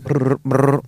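rolled brr of a ringing phone; imitated phone ring.

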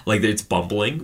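ordinary delivery

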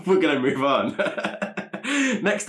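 talking through giggles; laughing. Real, not acted. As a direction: try to move on while giggling. Laughs while changing the subject.

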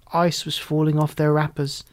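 plain reading voice

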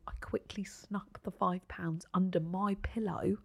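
conspiratorial whisper. Real, not acted. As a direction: whisper like sneaking around. Secretly planting tooth fairy money.

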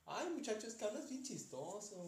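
put-on high, amused voice of an old lady; old lady teasing the boy about his accent, lightly mocked high voice.

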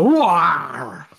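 drawn-out mock swoon noise; hammed-up quivering cry; shivering wail. Imitating listeners swooning at the accent, drawn-out wail. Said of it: "can't help but quiver, shiver, and go".

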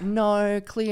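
exaggerated imitation of Australian vowels; mock Australian accent. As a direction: mimic the Australian "no" teasingly. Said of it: "People over here always do that".

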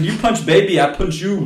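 loud, broken-English tough-guy threat; Schwarzenegger impression. Russian woman threatening him, Schwarzenegger style. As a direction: threaten like an action hero. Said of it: "She's Arnold Schwarzenegger".

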